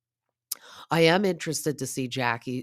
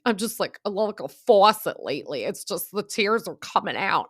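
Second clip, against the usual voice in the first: mock tearful, higher-pitched voice; Rachel; 6 semitones higher, 4 dB louder than usual. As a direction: imitate someone upset and overflowing with tears. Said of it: "why Rachel's so upset".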